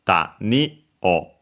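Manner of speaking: "Tani o" rising on ni, dropping on o; accented "Tani o", pitch falls on the final o; low-high-low pitch accent. Shown as a demonstration. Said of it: "low, high, low".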